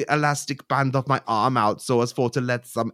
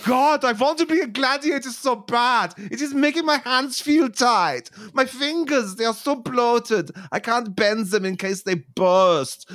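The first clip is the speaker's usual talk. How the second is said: much higher, desperate character whine; wacky [name]; 9 semitones higher than usual. Acted. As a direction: beg to be a gladiator.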